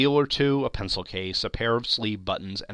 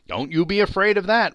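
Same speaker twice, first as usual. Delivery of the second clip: higher, louder crude woman; brash charwoman; 9 semitones higher, 7 dB louder than usual. A narrator's reading. Charwoman bragging to Joe, high and loud. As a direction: boast over the plunder loudly.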